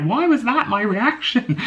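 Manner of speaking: high, slow, far-off squeak of self-disbelief; mock your own past self in disbelief; exasperated at herself. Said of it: "I'm like".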